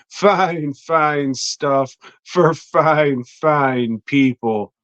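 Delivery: slow, stretched salesman croon; slow, mock-smooth ad read; drawn-out ad pitch. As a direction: savour each fine like a cheesy pitchman.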